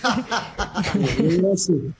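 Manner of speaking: laughing. Real, not acted. Host finally asks the match question.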